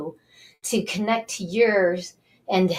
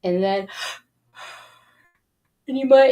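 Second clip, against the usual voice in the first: ordinary talk, nothing marked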